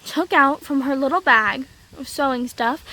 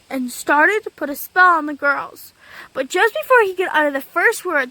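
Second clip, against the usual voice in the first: ordinary delivery, nothing marked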